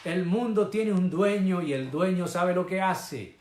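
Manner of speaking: warning voice. Acted. A voice warning the church that the world has an owner, warning call. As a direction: call out a high, urgent caution. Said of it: "una voz que dice".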